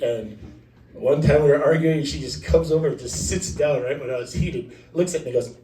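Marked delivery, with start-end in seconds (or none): none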